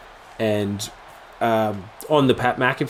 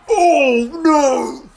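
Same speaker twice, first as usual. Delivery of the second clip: very high, loud mock wail; shocked reaction; 5 dB louder than usual. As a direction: stretch it out in high-pitched dismay. His shocked reaction to the insult.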